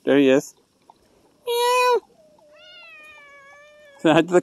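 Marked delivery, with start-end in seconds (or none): 1.5-2.0 squeaky imitated cat meow; high mock meow.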